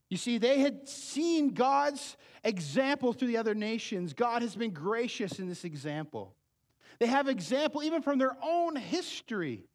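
loud, high fervent preaching; preaching at full force. Acted. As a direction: drive the warning home to the congregation. Preaches to the congregation.

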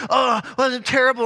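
hammed-up sulky player after striking out; mopey kid. Coach mimicking a kid who just struck out, mock whine. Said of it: "be like".

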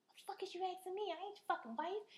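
high-pitched, incredulous comeback; mock-offended retort in a high voice; acted indignation. Acted indignant reply to his questions.

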